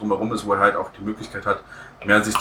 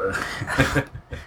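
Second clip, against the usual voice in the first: short laugh; 3 semitones higher than usual. Real, not acted. Laughs after admitting Nolan fandom.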